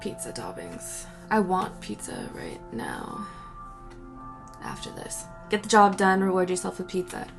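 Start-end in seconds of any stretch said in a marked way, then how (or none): none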